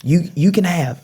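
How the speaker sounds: high, eager voice of a caught man; high scared giveaway. Retold or imitated. Caught dude to Frankie, high scared offer. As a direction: hand it all over fast and scared.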